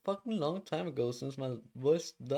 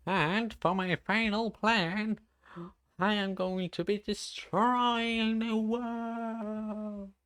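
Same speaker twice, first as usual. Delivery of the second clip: high, slow hammed-up supervillain announcing his plan; villain voice; 8 semitones higher, slower than usual. Streamer hams up a villain plan for chat, high slow mock-evil voice. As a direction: ham it up like a cartoon evil mastermind. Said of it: "I'm not going to be a fucking voice actor".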